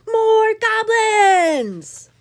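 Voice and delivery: very slow, loud high cry; cheer. Acted. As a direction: goblins greeting their king. Goblins cheering.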